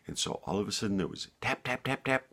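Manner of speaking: usual speaking voice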